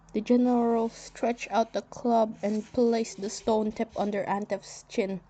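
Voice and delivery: ordinary delivery, nothing marked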